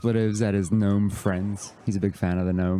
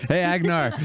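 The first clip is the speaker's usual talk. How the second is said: higher-pitched attempted Irish lilt; Irish accent; 10 semitones higher than usual. Shown as a demonstration. Hailing Agnar on the dock, mock Irish accent. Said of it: "I can do an Irish".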